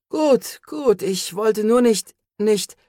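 hoch, langsam und abgehackt nach Luft suchen; mühsam nach dem Anfall Worte finden; geschwächt und stockend. A narrator's reading. (Cole spricht nach dem Anfall mühsam zu Rios, hoch und stockend)

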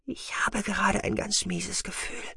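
high, soft female figure voice; uneasy woman. A narrator's reading. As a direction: confide it softly and high, uneasily. Uneasy woman after whispering. (hohe, leise Frauenstimme, beunruhigt)

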